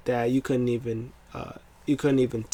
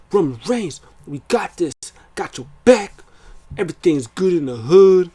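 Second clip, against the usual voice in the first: higher, louder hype man pumping up his partner; hype-up pep talk; 5 semitones higher, 6 dB louder than usual. Retold or imitated. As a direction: fire him up before the match, getting louder. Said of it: "Jay's trying to hype him up".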